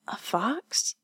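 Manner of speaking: faint breathy whisper; barely voiced, weak; breathless and weak. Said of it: "Her voice was breathless and weak".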